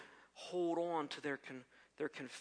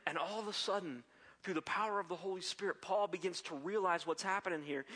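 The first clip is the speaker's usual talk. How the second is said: fervent preaching; 4 semitones higher, 5 dB louder than usual. Acted. Preacher to his congregation, rising in loud fervent preaching. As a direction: preach it loud and rising, driving each clause home. Preacher builds to full force.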